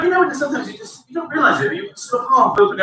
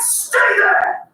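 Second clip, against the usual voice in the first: mock stern order; 8 semitones higher than usual. Parent barking at strapped kids.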